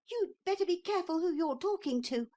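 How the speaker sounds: put-on high, fast boyish threat; indignant little boy. A narrator's reading. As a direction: puff up like an offended child. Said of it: "said Neville, suddenly losing his fear".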